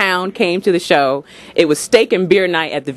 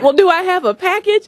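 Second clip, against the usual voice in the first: innocent question; 11 semitones higher than usual. Retold or imitated.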